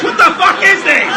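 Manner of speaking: high, loud exclamation; incredulous outburst. Real, not acted. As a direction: burst out in amused disbelief. Host mock-outraged at caller's timing.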